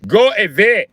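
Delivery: high, loud mock Indian accent. Robber shooing his victim, loud mock Indian accent. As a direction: shoo them off in an Indian accent. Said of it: "how you take an Indian guy serious".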